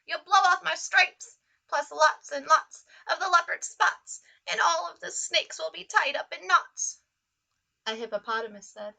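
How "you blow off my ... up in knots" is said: loud panicked zebra shout; zebra yelling. Acted. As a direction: yell it out in alarm, louder. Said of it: "The zebra yelled".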